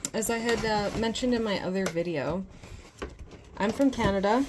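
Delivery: ordinary talk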